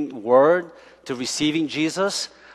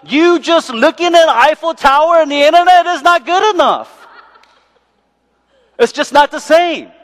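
higher, louder, excited invitation; Holy Spirit speaking; 10 semitones higher, 6 dB louder than usual. Acted. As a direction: coax them to come and see it in person. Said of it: "He wants to come in and says".

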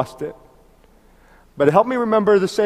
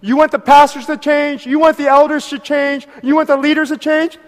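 preaching at full force; 10 semitones higher, 7 dB louder than usual. Acted. Pastor exhorting his congregation, in full preaching cry. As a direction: thunder it out to the whole church.

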